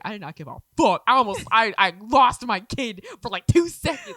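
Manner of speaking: loud retold exasperation; mother's outburst. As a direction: punch it out as an overwrought mom. Voicing distraught mother's outburst.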